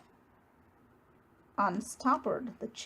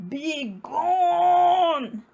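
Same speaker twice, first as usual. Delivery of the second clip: loud, higher bellowing roar; 5 semitones higher, 6 dB louder than usual. A narrator's reading. Bear roaring at the mouse to leave. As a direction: roar him out the door. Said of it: "roared Bear".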